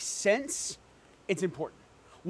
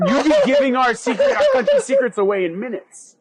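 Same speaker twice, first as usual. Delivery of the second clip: laughing; 3 semitones higher, 7 dB louder than usual. Acted. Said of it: "starts to chuckle, then full-on laughs".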